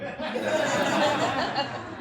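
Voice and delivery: a burst of high giggling; short high-pitched laugh; high giggle. Real, not acted. Laughs at own Playboy reveal.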